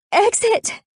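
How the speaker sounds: high, loud panicked shriek. A narrator's reading. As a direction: scream the word out in panic. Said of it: "I shriek this time".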